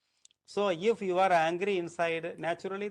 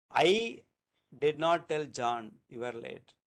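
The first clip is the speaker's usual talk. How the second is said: I pitched up, then a pause; stress on I; 3 semitones lower than usual. Shown as a demonstration. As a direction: set "I" apart before the rest. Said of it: "we are giving more stress".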